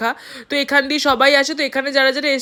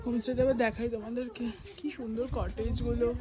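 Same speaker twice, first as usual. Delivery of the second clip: slightly winded while climbing steps; climbing stairs; 13 dB quieter than usual. Real, not acted. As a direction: talk while walking up stairs. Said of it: "সিঁড়ি দিয়ে উঠছি".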